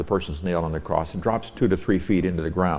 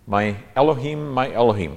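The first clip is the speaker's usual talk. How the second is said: loud, higher pleading call of the name; a raised, aching call; anguished cry; 6 semitones higher, 6 dB louder than usual. Acted. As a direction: cry out to God from the cross. Voicing Christ's cry on cross.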